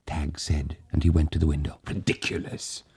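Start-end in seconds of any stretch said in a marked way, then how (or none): 1.9-2.8 unvoiced, dismissive; whispered scoff. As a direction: scoff under the breath.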